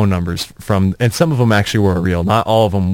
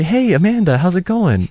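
bright, much higher mock greeting; casual greeting; 10 semitones higher than usual. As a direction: pitch it way up, breezy and friendly. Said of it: "go up to a girl and say".

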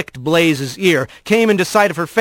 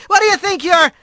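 mild mock shout, much higher and cut off; 14 semitones higher, faster than usual. A narrator's reading. Drugged Rat shouts at the kit fox, a mild mock shout, much higher. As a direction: yell at the fox until the words break off.

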